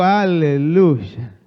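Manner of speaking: loud, long drawn-out hallelujah; congregational hallelujah. Real, not acted. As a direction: belt it out slowly for the crowd to echo. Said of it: "Decí conmigo". (aleluya fuerte y alargado para la congregación)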